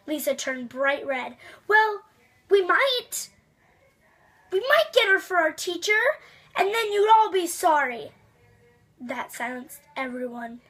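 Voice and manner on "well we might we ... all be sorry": put-on higher and louder, indignant girl snapping back; embarrassed girl hitting back, pitched up and loud; defiant retort. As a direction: fire back at the laughing class. Girl answers back after class laughs.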